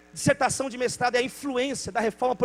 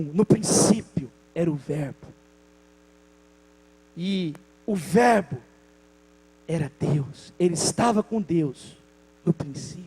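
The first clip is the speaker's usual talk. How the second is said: lower, slow, solemn reading of the verse; solemn scripture reading; 7 semitones lower, slower than usual. Acted. Preacher reading John's Gospel to the church, solemn lower reading. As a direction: read it as holy words.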